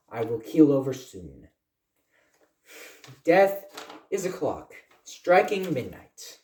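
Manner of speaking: dramatic poem reading, phrases swelling then falling away; poem recitation. Acted. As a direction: declaim each line loftily, with long pauses between phrases.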